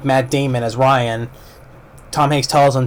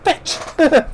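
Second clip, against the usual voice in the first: much higher, slow, half-laughing, half-crying groan of a curse; wounded curse; much slower than usual. Retold or imitated. Shot soldier curses his wound, high strained slow groan. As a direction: spit it out like you just got shot. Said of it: "his reaction when he says".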